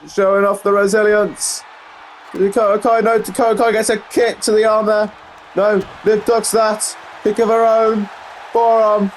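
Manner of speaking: high-pitched live fight call; excited play-by-play. Real, not acted. Commentator calling Liv fighting back, excited play-by-play. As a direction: follow each blow with excitement.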